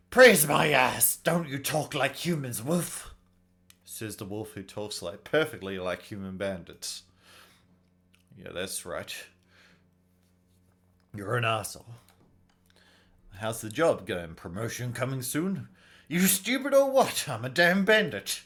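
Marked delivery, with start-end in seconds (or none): 0.1-3.2 loud burst, easing off after; irritated scoff. Acted. Bandit rejecting the pious talk, irritated. 16.1-18.4 high, loud bandit retort; indignant outburst. Acted. Bandit answering talk of job promotion, indignant.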